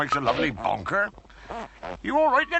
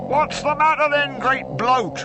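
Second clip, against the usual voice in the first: calling out; 10 semitones higher, 4 dB louder than usual. Acted. Berk calls out to Wodge.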